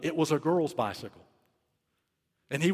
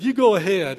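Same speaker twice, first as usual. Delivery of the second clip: put-on friendly teens; 6 semitones higher, 10 dB louder than usual.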